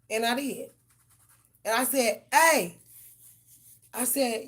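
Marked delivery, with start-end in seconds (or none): 2.3-2.8 calling up. Retold or imitated. Yelling up at cat in tree.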